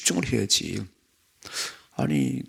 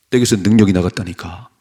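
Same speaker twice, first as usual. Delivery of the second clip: firm, raised-voice retort; loud insistence; 7 dB louder than usual. Acted. Jesus answering the disciples, loud insistence. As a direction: insist on it forcefully.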